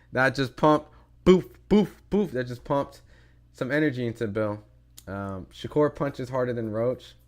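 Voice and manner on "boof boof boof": punch sounds. Retold or imitated. Imitates punches landing.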